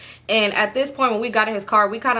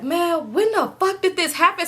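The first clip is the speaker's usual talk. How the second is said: high-pitched mock outrage; retold cussing outburst; 8 semitones higher than usual. Retelling his reaction to the busted window, mock outrage. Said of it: "he cussing and yelling and stuff".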